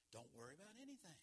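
quiet repeat. Real, not acted. Repeating the verse softly.

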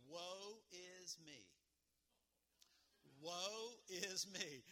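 very slow, drawn-out mournful sigh; subdued lament. Shown as a demonstration. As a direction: moan it out slowly with mock sorrow. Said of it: "a little bit more subdued, but still with enthusiasm".

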